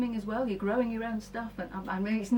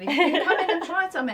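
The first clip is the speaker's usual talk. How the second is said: laughing; 7 semitones higher, 6 dB louder than usual. Real, not acted. Teases Ed looking in longingly.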